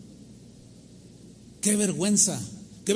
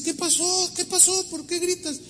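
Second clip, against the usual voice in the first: very high-pitched worried questions; high acted alarm, not shouted; alarmed girl; 11 semitones higher than usual. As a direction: imitate a startled girl asking urgently. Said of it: "y viene así".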